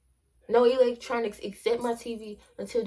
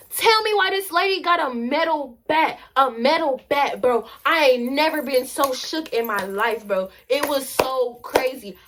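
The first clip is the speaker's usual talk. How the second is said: loud, raised-pitch storytime disbelief; big, loud incredulous outburst; shocked exclaiming; 4 semitones higher, 9 dB louder than usual. Real, not acted. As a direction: exclaim it loudly to the audience, totally shook. Storyteller's shock at the metal bat.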